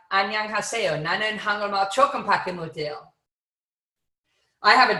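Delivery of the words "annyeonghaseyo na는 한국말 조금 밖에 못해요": quick Korean greeting; spoken Korean. Shown as a demonstration. Speaker demonstrating Korean to the audience, a quick Korean greeting. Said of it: "suddenly speak Korean and you're like".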